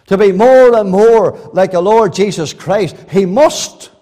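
loud, ringing pulpit delivery; fervent sermon voice; full-force preaching. Acted. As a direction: preach it out to the whole hall. Preacher building to a climax.

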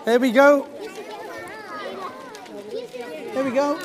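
put-on rally the kids to the front; kindergarten teacher voice. Calling children up to sing.

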